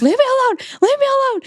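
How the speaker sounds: high-pitched, pleading repeated; mock frantic plea. Fleeing her calm husband, high-pitched mock plea. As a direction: squeal it three times like a cornered cat. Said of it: "I'm just".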